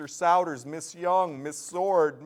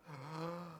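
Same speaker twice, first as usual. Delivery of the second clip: big gasp; 13 dB quieter than usual. Real, not acted. Announcer gasping in air before rattling off names. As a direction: suck in air to read the list in one go. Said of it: "One breath".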